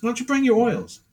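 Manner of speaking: high, breezy imitation of Frank; Frank asking. Frank on the phone suggesting oils, high and breezy. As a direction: pitch it up high, casual suggestion. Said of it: "He goes".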